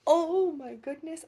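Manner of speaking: loud, slow delighted exclaim; excited gush. Acted. Gushing to a little friend about Wicked, loud and delighted. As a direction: burst out with joy.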